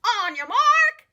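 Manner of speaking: slow, loud, drawn-out starter call; kookaburra announcer. Acted. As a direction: call the start like a race official. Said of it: "said the kookaburra".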